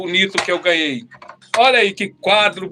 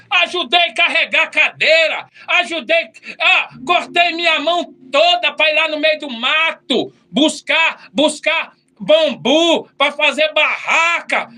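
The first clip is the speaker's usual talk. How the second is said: angry complaint; 6 semitones higher than usual. Retold or imitated. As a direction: vent the grievance in a shout.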